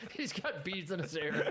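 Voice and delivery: slow, chuckling through it; amused, half laughing. Real, not acted. Picturing the old coach, laughing.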